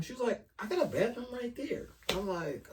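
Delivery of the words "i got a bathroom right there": high, soft imitation of a woman; a hint of a woman's quiet, higher voice; lightly voiced woman. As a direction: imitate her quietly, pitched up. Acted quote of the woman.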